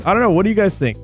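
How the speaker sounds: ordinary talk, nothing marked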